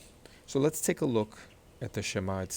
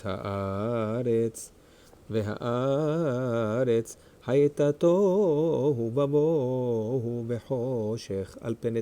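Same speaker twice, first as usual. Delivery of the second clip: Torah reading aloud. Shown as a demonstration. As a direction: read the verse with long, held stressed vowels.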